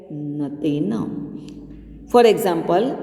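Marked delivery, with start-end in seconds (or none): 0.1-0.9 "न तेन" softly sung as one joined word; "न तेन" softly in a low sing-song, joined; joined reading. Shown as a demonstration. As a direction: hum "न तेन" low and soft, run together. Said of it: "solved by reading it as".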